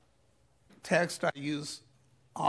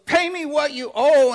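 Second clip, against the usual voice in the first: loud, high, furious demand; angry demand; 11 semitones higher, 16 dB louder than usual. Retold or imitated. Forgiven servant seizing his fellow servant, furious loud demand. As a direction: bark it at the debtor like a threat. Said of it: "and he goes".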